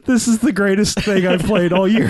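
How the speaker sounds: high, laughing through the words. Retold or imitated. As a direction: gush it out while laughing. Retells own delighted reaction.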